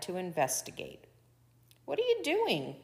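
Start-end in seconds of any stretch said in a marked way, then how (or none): 1.9-2.8 high-pitched demand; sharp question from an offended coyote. A narrator's reading. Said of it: "Coyote demanded".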